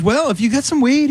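high, easygoing officer voice; cheerfully friendly Canadian guard; nice customs officer. Retold or imitated. Officer directs comedian down the hallway, high and friendly. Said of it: "And he was so nice".

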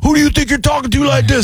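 high outraged yell, shouted; hammed-up angry yell; imitated shout. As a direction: shout back like a furious fake cop. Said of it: "He starts yelling".

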